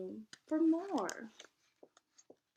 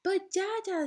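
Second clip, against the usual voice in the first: Musa protesting; 7 semitones higher, 4 dB louder than usual. Acted. Musa objects.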